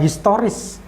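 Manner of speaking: ordinary talk, nothing marked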